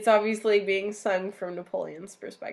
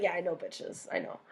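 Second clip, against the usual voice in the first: softer, smug brush-off; 3 semitones lower, 7 dB quieter than usual. Retold or imitated. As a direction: shrug it off, smug. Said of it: "he's like".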